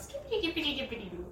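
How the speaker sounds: lightly voiced kid rhyme; sing-song Laura. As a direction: chant it like a carefree little girl. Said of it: "And then Laura goes".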